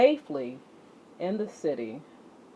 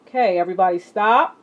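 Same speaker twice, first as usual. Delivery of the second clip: louder, quick call to halt; loud stop call; 9 dB louder, faster than usual. Acted. Teacher stopping the exercising class, loud and quick. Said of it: "calling the class to stop".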